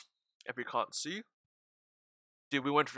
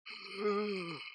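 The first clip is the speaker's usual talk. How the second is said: high, drawn-out zombie moan; zombie groan; 7 semitones higher, 4 dB quieter than usual. Pretend. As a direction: groan like the undead. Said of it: "That's my zombie sounds".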